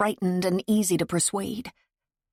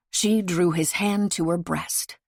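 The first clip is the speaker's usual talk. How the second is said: ordinary delivery, nothing marked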